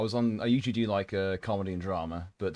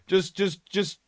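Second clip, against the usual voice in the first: pleading; 10 semitones higher, 5 dB louder, slower than usual. Retold or imitated. Quoting crew in heat.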